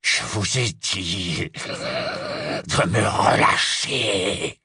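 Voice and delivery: very slow, rasping creature; raspy growl. Acted. Held werewolf insists on release, raspy growl. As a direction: drag each word out in a hoarse growl. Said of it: "de sa voix râpeuse".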